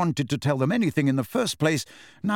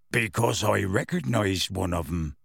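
growled; 5 semitones lower than usual. A narrator's reading. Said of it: "Hagrid growled".

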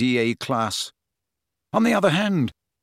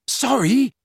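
high urgent yell; yelled apology; 10 semitones higher than usual. Acted. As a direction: call it out in alarm. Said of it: "yelled Harry".

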